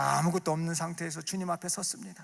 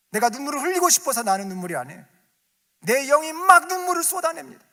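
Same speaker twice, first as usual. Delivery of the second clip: faster, then much louder and higher; building fiery preaching; 11 semitones higher, 12 dB louder than usual. Acted. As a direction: let the fervour boil over. Rising fervent preaching. (점점 고조되는 뜨거운 설교)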